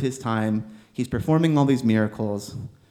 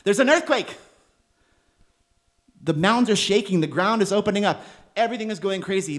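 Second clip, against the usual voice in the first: much higher, urgent preaching of the earthquake; sermon climax, much higher and urgent; dramatic excitement; 8 semitones higher than usual. A narrator's reading. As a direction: paint the quake with rising awe.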